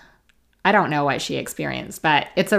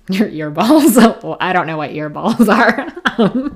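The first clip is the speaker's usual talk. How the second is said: real laughter breaking through, much higher and louder; cracking up helplessly while trying to talk; laughing loud; 4 semitones higher, 10 dB louder than usual. Real, not acted. Podcaster tickled by her own word earballs, laughing loud. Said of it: "listen to me laugh loud".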